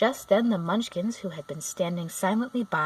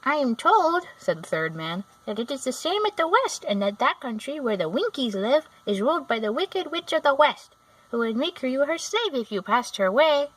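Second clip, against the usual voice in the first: higher, louder little man; Munchkin voice; 6 semitones higher, 5 dB louder than usual. A narrator's reading. A Munchkin warns Dorothy of the desert, high and little. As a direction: pipe it up gravely.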